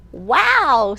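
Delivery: a bright, loud wow of wonder; owl's wow. Acted. Reading to library kids, the owl's loud amazed wow. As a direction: owl amazed at the new sight. Owl exclaims in picture book.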